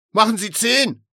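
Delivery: loud, high command; impatient high yell; insistent shout. A narrator's reading. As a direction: demand it at the top of your voice. Caller insists loudly on ten.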